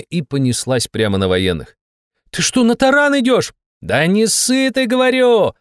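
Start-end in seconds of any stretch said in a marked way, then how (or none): 2.3-3.5 very high, alarmed question; alarmed cry. A narrator's reading. Passenger sees the jeep charging at soldiers, alarmed. 3.8-5.5 high, drawn-out holler; shout. A narrator's reading. Driver shouts down her frightened passenger, hollering. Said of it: "крикнула она".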